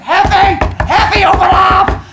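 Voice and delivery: very high, very loud, slow hollered nickname; yelling at the door. Retold or imitated. Zeus banging on door.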